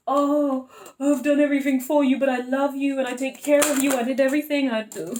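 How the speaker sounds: mock self-pity, hammed up; woe is me. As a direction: whine it like a martyr who gave everything. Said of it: "that's definitely woe is me".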